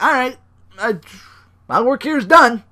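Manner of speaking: high, loud mock Brock voice; Brock impression. Wrestler leaving after his work is done, high and loud. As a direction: ham it up as a gloating Brock. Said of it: "Brock's like".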